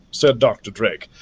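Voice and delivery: ordinary delivery, nothing marked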